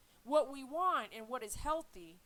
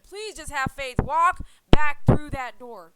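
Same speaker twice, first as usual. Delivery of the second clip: slow, louder firm plea; pleading prayer, louder; 4 semitones higher, 18 dB louder, slower than usual. Retold or imitated. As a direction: call the dog back with faith. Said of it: "But I literally said".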